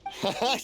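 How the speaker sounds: light laugh. Acted. Shopkeeper agrees with Paimon.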